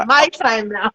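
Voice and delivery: gleeful, breaking into laughter; laughing, triumphant. Retold or imitated. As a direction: crack up while claiming your turn. Gleefully retells dad leaving.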